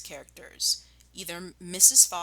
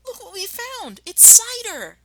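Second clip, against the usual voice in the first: put-on much higher, delighted child cry; excited cry; 14 semitones higher, 10 dB louder than usual. The smallest fox calling to his dad. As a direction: squeal with discovery. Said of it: "he cried out".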